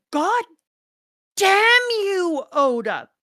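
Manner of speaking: high, loud playful cry of outrage at the author; mock outraged yell. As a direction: shout it out, fake-furious at Oda. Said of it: "And I was like".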